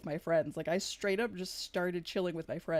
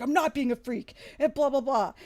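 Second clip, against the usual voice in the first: higher, louder indignant imitation; mock pressed protest; 5 semitones higher, 7 dB louder than usual. Pressed stranger denies being a freak, mock outrage. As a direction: imitate him getting worked up, ending in blahs. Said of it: "he was just so pressed".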